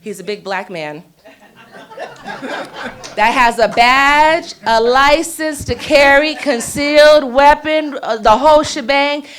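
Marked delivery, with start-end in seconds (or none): 1.2-3.1 airy laugh. Real, not acted. Laughing while introducing lieutenant. 3.1-9.2 loud, slow, laughing hype-up; excited hype. Real, not acted.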